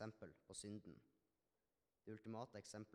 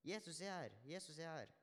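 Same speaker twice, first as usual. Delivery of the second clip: higher-pitched, restrained cry of news; calling out; 6 semitones higher than usual. A narrator's reading. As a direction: call out the news, pitch lifted. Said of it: "kommer løpende og ropte".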